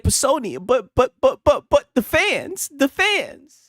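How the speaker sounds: high, slow, pleading stammer; mock the guy begging about fans; a hammed-up pleading stutter; whiny stammer. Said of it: "He'd be like".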